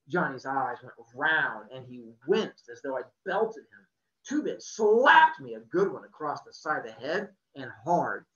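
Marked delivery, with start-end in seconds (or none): none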